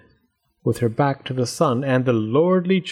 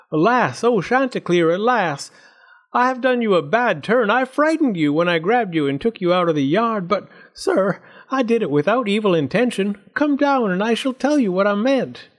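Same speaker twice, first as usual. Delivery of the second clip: higher wheedling fox voice; pleading fox; 7 semitones higher than usual. A narrator's reading. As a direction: coax him down from the tree.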